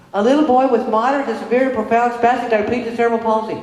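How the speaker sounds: high, chirpy list of the diagnosis; reciting diagnosis. Retold or imitated. Teacher Renee reciting the boy's diagnosis, chirpy and high. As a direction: rattle off the medical label brightly.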